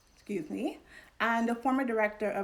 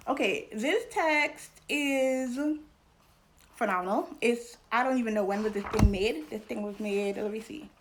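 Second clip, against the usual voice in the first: normal talking voice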